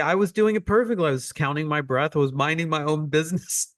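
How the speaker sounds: put-on wronged little child. Student struck while doing it perfectly, mock aggrieved. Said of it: "You're the little child".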